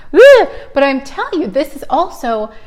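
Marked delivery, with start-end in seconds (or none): 0.1-0.5 a high, loud, over-the-top "Ugh"; wild exasperated groan. Retold or imitated. Host acting out her hyper self, high loud groan. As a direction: blurt it out, buzzing with too much energy. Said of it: "And now I'm like".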